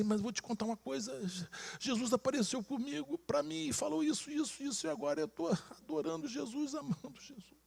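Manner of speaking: high, soft, choked-up confession; emotional retold confession. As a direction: pour it out softly in a high, fragile voice. Wife's tearful confession, acted.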